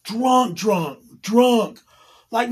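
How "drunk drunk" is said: usual speaking voice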